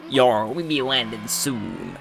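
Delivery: slow, drawn-out sea captain; salty sailor character voice; put-on pirate voice. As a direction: play the pirate captain, stretching each word. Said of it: "I don't know why I change his voice".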